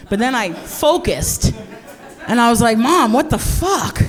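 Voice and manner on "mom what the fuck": higher, drawn-out shocked exclamation; appalled outburst. Retold or imitated. Adult comic retelling shock at mom's necklace, appalled. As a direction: exclaim it in grown-up disbelief. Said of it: "so I didn't say it like that".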